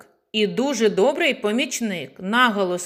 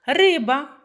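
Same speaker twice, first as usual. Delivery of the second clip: риба called out with the first syllable RY stressed and higher; calling the word out; 6 semitones higher, 3 dB louder than usual. Shown as a demonstration. Teacher calls out a word to find the stress, calling риба. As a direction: call out риба, leaning on RY. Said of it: "необхідно слово погукати".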